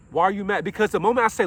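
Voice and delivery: normal talking voice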